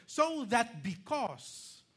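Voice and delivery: "So that because" stretched slow, high and loud; emphatic scripture reading. Shown as a demonstration. Preacher showing the reason in the verse, "So that because" slow and emphatic. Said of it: "look at how emphatic".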